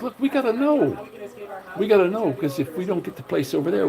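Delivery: high, pressing plea to his wife; urgent retelling. Retold or imitated. Telling the landlord lady they must know, high and urgent. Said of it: "and I'm like".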